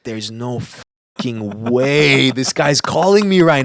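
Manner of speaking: loud, drawn-out astonishment; amazed disbelief. Retold or imitated. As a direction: stretch it out, stunned and thrilled. Retold disbelief at the phone call.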